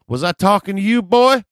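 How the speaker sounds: high, loud, rising challenge; confrontational guy impression. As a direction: shout down an upstart as the tough guy. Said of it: "do my best impression of this guy".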